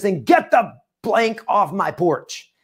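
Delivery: high, drawn-out angry order; mock yell. Homeowner ordering the agent off his porch, mock yell. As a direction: imitate an irate homeowner without full volume. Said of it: "let out this big yell from".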